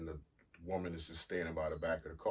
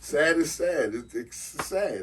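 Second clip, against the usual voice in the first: raised voice, high and loud; indignant insistence; 10 semitones higher, 13 dB louder, slower than usual. Real, not acted. Host defends calling the story sad, loud indignant insistence. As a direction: push back hard, loudly indignant. Argues back about the word sad.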